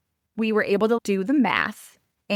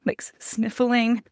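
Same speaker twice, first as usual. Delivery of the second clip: ordinary talk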